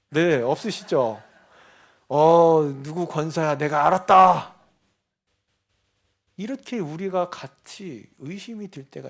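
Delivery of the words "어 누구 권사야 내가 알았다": God's voice. Retold or imitated. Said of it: "들어보신 분 계세요?".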